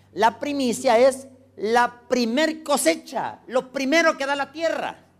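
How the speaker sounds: emphatic preaching. Acted. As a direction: drive the lesson home from the pulpit. Stressing key words to congregation.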